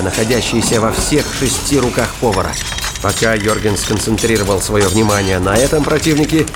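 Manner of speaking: plain reading voice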